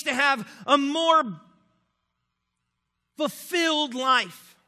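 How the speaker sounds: loud, impassioned sermon voice; preaching at full force. Acted. Pastor preaching to his congregation about the church's self-focus, impassioned and loud. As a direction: drive the point home to the pews.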